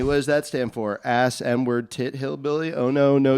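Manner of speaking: ordinary talk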